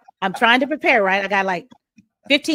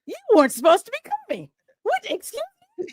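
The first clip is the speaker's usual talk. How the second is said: high-pitched, incredulous surprise; retold flabbergasted reaction, pitch way up; astonished; faster than usual. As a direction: question them in squeaky disbelief. Shock at seeing unexpected guest.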